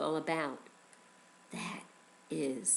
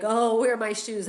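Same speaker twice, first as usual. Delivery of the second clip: mock-flustered, louder outcry; flustered searcher; 4 semitones higher, 7 dB louder than usual. Teacher imitating Israelites unready to leave, flustered. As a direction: act a little panicked over missing shoes.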